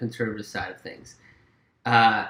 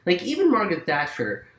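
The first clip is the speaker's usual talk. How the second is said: ordinary delivery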